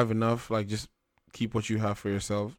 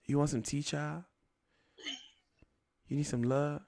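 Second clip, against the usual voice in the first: soft, higher-pitched imitation of a grandmother; old grandma; 5 semitones higher, 8 dB quieter than usual. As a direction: offer it gently, like an old granny chatting. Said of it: "like, an old grandma having a conversation with you".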